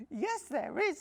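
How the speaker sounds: high, loud little cub voice; a small bear cub thanking sweetly. Acted.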